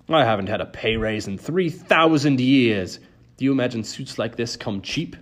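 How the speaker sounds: lightly voiced ferryman of the dead; a hint of a put-upon grumbler; grumbling ferryman. A narrator's reading. Charon complaining about his job.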